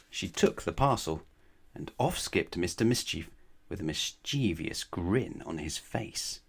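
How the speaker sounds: ordinary delivery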